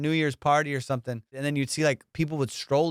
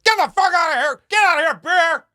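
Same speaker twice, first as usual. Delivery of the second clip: higher, much louder yell to scare off a bear; big scary yell; 9 dB louder than usual. Shown as a demonstration. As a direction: roar it out to make yourself big. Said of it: "You gotta make yourself big".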